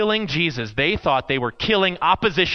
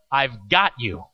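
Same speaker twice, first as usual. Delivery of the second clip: reassuring; 7 semitones lower, slower than usual. Retold or imitated. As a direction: settle a scared kid's nerves. Leader reassures scared boy.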